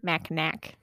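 Mackinac said as mak-i-nak, every letter read; spelled-out pronunciation. Shown as a demonstration. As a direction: read Mackinac just as it is spelled, ending in a hard k. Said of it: "I always pronounced it Mackinac as a kid".

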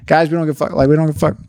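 ordinary delivery

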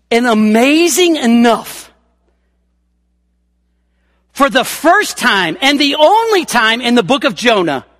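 loud, high, building preacher's emphasis; full-force preaching. Acted. Preacher driving the point to the congregation, loud. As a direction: hammer home the only happy moment.

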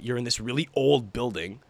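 ordinary talk

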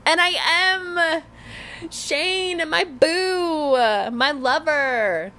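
high, slow squeal of delight; excited gushing. Real, not acted. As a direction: gush over your friend like a lovestruck fan. Gushing about friend Shane's wedding.

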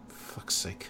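whispered under his breath; annoyed newbie muttering; muttered grumble. Acted. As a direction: grumble it to yourself, barely voiced. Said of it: "brushes himself off, like".

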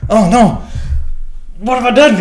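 mock panic. Panicking over the big event.

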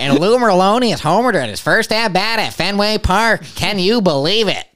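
high, slow announcer home run call. Pretend. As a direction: belt it out like a radio play-by-play man. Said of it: "give us a home run call".

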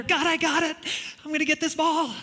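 high, eager mock shout of overconfidence. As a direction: rush off shouting you've got it. Eager believer running off.

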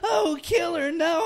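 high, whimpering mock plea; mock crying victim. Retelling horror parody, high fake-crying victim. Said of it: "She's like".

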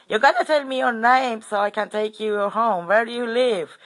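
high, loud, insistent retold voice; imitating angry-sounding man. Old bloke demanding a name to take them home, angry-sounding. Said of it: "he sounded really angry to me".

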